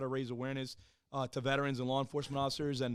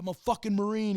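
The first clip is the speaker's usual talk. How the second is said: higher, louder mock-indignant retort; indignant Marine; 7 semitones higher, 6 dB louder than usual. Wounded Marine correcting the medics, indignant. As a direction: bark it back, insulted. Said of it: "And I was like".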